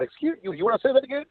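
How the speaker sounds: high, sassy challenge; mock indignant. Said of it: "I said".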